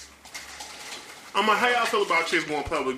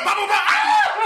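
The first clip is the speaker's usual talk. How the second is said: high, loud, slow shouted gunshot noises; hammed-up shouting of shots; mock gunfire; 7 dB louder, slower than usual. Friend imagining the officer opening fire, hammed-up gunshot shout. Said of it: "They be like".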